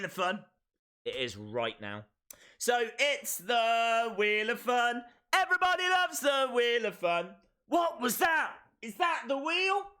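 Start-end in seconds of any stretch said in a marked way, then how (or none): 2.6-9.9 big playful high-pitched build-up; excited hype. Pretend. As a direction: hype the game up like a big event. Hyping the Wheel of Fun.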